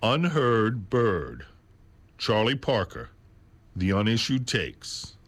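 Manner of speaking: slow, measured title announcement. Shown as a demonstration. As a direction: give the title slowly, pausing after each part. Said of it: "I'll say it again slower".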